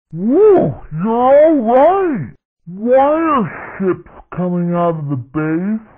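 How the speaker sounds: animated battle-radio sidekick lines; game character voice. Acted. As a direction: act out short cartoon combat callouts.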